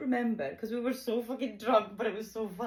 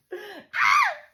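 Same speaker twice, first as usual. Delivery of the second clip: high, loud burst of laughter; loud cackle; 7 dB louder than usual. Real, not acted. Laughing at her own party story, high loud cackle.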